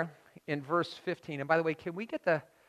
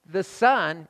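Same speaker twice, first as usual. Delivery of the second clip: ordinary talk, nothing marked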